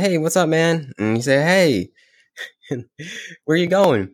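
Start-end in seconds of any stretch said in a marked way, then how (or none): 0.0-0.9 cheerful dancing man. Retold or imitated. Dancing man greets the monk. 1.5-4.1 cheerful dancing man. Retold or imitated. Dancing man greets the monk.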